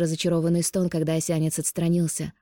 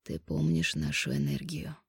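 much lower, slow seductive man; low male voice; 13 semitones lower, 8 dB quieter than usual. Acted. Male lover voiced by narrator.

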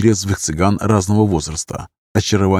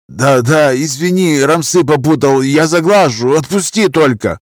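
higher-pitched, submissive apology; pleading drunk; 6 semitones higher, 4 dB louder than usual. A narrator's reading. Drunk held by chin, pleading apology. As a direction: beg to be let go. Grabbed drunk begs for release.

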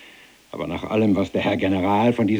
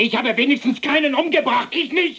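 sehr hoch, aufgebracht herausgeschrien; aufgebrachter Ausruf. Acted. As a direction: verzweifelt und wütend kontern. Ausbruch im Streit. (sehr hoher, aufgebrachter Aufschrei)

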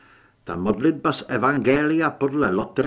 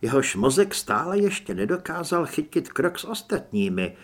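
usual speaking voice

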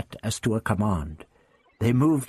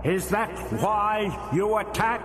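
high, slow, hollow and accusing; booming alien voice; 7 semitones higher, slower than usual. A narrator's reading. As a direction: interrogate him from nowhere. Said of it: "The voice boomed".

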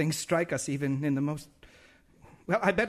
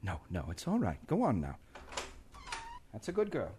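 gentle reassurance; 9 dB quieter than usual. Acted. Reassuring the girl softly.

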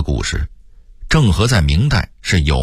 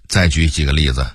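ordinary delivery

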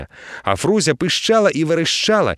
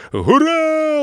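very high drawn-out hurrah; a crowd of sailors cheering; crew cheer; 14 semitones higher than usual. A narrator's reading. Crew cheering behind the captain, high hurrah. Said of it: "озвалася за спиною команда".

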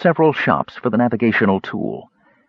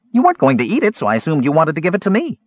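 high-pitched, quick self-justification; indignant kender; 8 semitones higher, faster than usual. A narrator's reading. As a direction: argue back in a high little voice. Kender figure in the argument.

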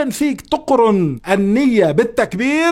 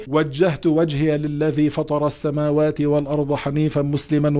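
recitation; 4 semitones lower than usual. Acted. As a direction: recite the opening dua devoutly. Recites opening supplication.

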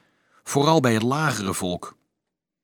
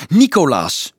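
a loud, higher call of a name; 4 semitones higher, 6 dB louder than usual. A narrator's reading. Dolf struck by an idea, calling out loud. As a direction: shout the name as a sudden idea. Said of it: "riep hij".

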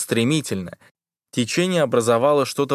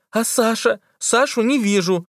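put-on high worried girl's cry; anxious call; 11 semitones higher than usual. A narrator's reading. Liza anxiously calling. (тревожный оклик)

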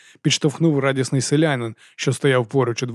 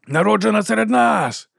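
a higher, mild rally shout with a raised fist; shouted cheer; 9 semitones higher than usual. A narrator's reading. Another labourer shouts praise of Merkato. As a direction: cry it out for the crowd. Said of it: "крикнув інший".

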